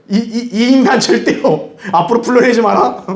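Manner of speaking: stammered high outburst with laughter; mock indignation. Old friends banning someone on a group call, sputtering indignation. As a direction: sputter a hammed-up banishment, pitched high.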